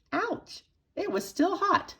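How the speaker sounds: ordinary delivery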